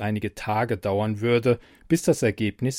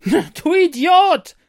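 very high, loud, slow insult; furious slow yell; 10 dB louder, much slower than usual. Acted. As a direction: drag out the insult in rage. Brigitte insults computer.

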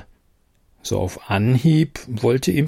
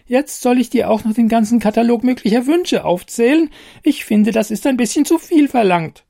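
much higher, squeaky and put-upon; a tiny fairy refusing; snippy fairy; 11 semitones higher than usual. Acted.

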